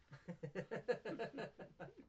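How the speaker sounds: high giggling laugh; amused laugh. Real, not acted. Laughing at the story.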